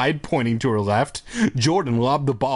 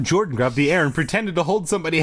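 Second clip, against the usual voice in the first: plain reading voice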